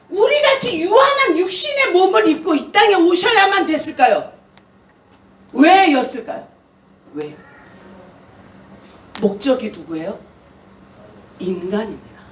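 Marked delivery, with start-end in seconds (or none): none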